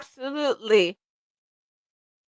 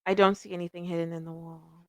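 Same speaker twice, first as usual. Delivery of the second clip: softer repeat of the line, checking again; quieter repeat; 4 semitones lower, 10 dB quieter than usual. Real, not acted. Reader sees nothing hidden, repeats it quietly. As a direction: say it again under her breath, unsure. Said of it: "maybe I just don't see it".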